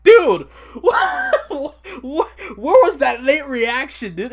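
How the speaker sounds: very high, loud laughing shout; cracking up while yelling at him; hammed-up laughing outburst; laughing disbelief. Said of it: "I'm like".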